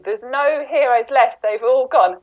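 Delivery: usual speaking voice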